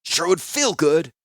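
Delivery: bitter prisoner hissing back at the healer; hissed. A narrator's reading. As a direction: savour the thought of revenge. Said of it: "Klars hissed".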